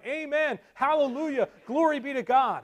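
high congregational shouts of acclaim; crying out praise. Retold or imitated. Methodist brethren answering the sermon, crying out. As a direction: call each phrase out high, then let it fall. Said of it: "nodding their heads and crying".

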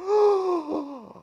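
loud, high intake of breath; deep shocked gasp. Retold or imitated. Methodists hearing the doctrine of election, gasping deeply. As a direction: imitate a whole congregation drawing breath. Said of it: "There was a deep drawing of breath".

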